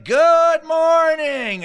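high, loud, slow sing-song greeting; cheery wake-up call. Retold or imitated. Camp counselor greeting the cabin, cheery loud sing-song. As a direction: burst out of bed greeting the day. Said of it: "he'd be like".

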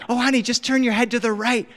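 high-pitched parental urging; insistent coaxing. Retold or imitated. Dad to daughter missing the rainbow, high insistent coaxing. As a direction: imitate a dad coaxing a confused child.

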